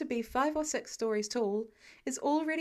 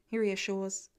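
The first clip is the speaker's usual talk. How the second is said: plain reading voice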